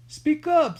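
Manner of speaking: loud, high call to speak up; a deaf old man calling out loud; loud demand. A narrator's reading. Old rat catcher to Jemmy, loud and high. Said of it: "cupping a hand to his ear".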